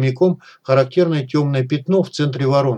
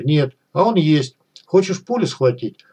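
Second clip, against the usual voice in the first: plain reading voice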